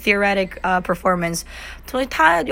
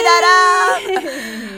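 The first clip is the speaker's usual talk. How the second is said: excited; 10 semitones higher than usual. Real, not acted. Presenting Disney with flourish.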